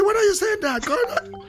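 high mock wife voice, rising; imitating his wife. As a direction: squeak it out like the surprised wife. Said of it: "She said".

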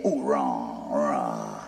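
deep groan. Pretend. Car failing to start.

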